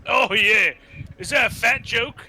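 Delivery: normal talking voice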